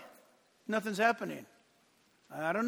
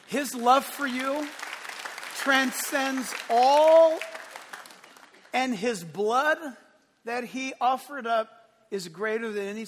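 slow, louder emphatic preaching; 4 semitones higher, 7 dB louder, slower than usual. Acted. Pastor urging the congregation, slow emphatic preaching. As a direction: lean on each word slowly.

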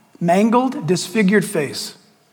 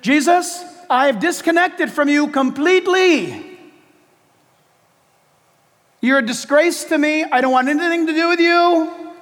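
high, raised bitter voice; bitter rejection; 7 semitones higher than usual. Acted. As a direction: reject him with hurt and scorn. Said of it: "Peter communicated to Jesus in his rejection".

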